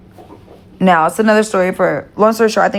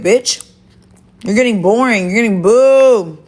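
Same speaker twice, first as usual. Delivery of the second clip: raised, mocking taunt; 4 semitones higher than usual. Real, not acted. To the rival she calls easy to beat, raised mocking taunt. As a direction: goad her like she's bored of winning.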